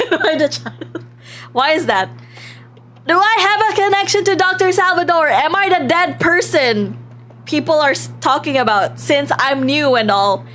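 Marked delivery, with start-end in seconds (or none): none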